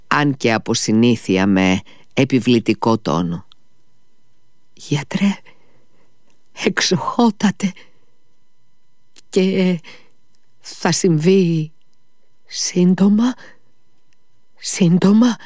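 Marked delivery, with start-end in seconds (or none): 4.7-15.4 high, slow anxious begging; father begging the doctor for hope, anxious pleading. A narrator's reading. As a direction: plead with the doctor, rising in dread. Desperate father begs doctor.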